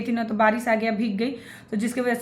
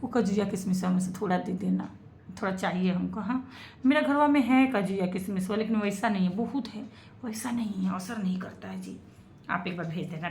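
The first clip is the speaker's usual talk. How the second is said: softer, lower, wheedling ask for cashews; sweet pleading; 4 semitones lower, 4 dB quieter than usual. Acted. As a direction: plead sweetly, almost cooing. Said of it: "देखो प्यार से बोल रहे हैं". (हल्की, मीठी आवाज़ में काजू-किशमिश माँगना)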